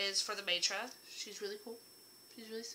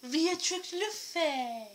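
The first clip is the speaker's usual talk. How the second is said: slow, much higher adoring repeat; 7 semitones higher, much slower than usual. Shown as a demonstration. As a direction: sing her name fondly.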